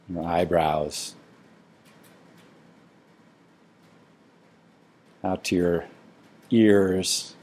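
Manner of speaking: soft, unhurried body-scan guidance with long pauses; quiet, spacious meditation-teacher delivery; guided meditation. Real, not acted.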